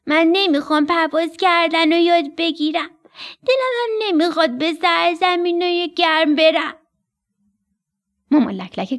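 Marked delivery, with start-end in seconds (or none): 0.1-6.8 higher, louder whiny chick voice; a little stork sulking, refusing to learn; stubborn young stork. Acted. Young stork refusing to learn flying, higher whiny voice.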